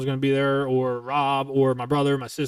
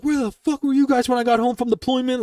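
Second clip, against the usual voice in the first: high, heated accusation at family; a hurt son confronting his absent family; angry accusation; 9 semitones higher than usual. Retold or imitated. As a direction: demand it of your family in anger. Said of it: "I was like".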